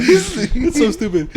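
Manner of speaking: high, loud, slow laughing words. Real, not acted. Host amused by own silly voice, laughing. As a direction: squeeze the words out through laughter.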